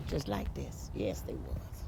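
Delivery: a hushed low confiding aside. A narrator's reading. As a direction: drop into a low whisper. Storyteller rocking, hushed aside.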